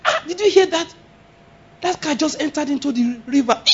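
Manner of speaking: high-pitched startled report; acted shock. Preacher plays a witness to a car accident, shocked.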